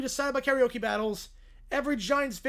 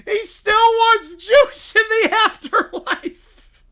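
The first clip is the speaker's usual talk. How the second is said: much higher, very loud, slow scream breaking into laughter; screaming kid; 10 semitones higher, 12 dB louder, much slower than usual. Acted. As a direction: scream it in terror, cracking up. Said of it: "Little Princess screams".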